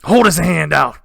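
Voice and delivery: a bandit chief breaking into a house; bandit king. Acted. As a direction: bellow to his men. Bandit king orders men.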